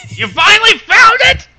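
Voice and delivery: high, loud disbelieving cry; loud startled exclamation; surprised shout. Real, not acted. Shocked at title found.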